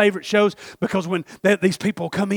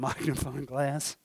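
usual speaking voice

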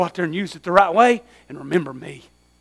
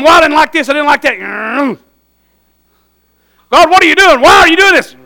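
louder, higher whiny grumble; mock complaining; 7 semitones higher, 8 dB louder than usual. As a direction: grumble at God. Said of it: "you start going".

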